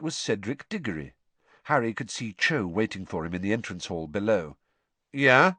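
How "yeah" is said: louder, higher cold questioning reply; a touch of cold challenge; coldly. A narrator's reading. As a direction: answer coldly with a rising edge. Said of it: "said Harry coldly".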